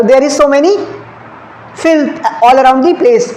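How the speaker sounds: very slow, word by word, reading the question aloud; slow dictation. Shown as a demonstration. Teacher reads the exam sentence to students, very slowly. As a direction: spell it out slowly so the class can follow. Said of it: "अब जरा match करो".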